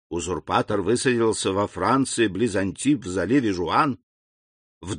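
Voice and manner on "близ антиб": ordinary delivery, nothing marked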